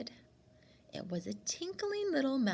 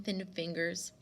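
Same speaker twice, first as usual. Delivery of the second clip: usual speaking voice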